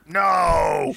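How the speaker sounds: drawn-out mock shout of refusal. Deli man rebuffing a customer's greeting, mock shout. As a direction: bellow it out as a comic rebuff.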